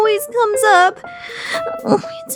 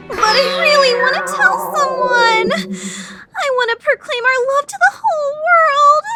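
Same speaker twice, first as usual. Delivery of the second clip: bursting to tell; 6 semitones higher than usual. Acted. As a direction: whine with pent-up longing to share it. Longing outburst in inner monologue.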